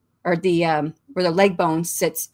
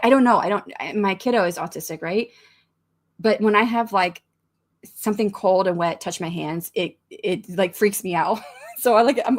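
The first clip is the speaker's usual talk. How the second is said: ordinary delivery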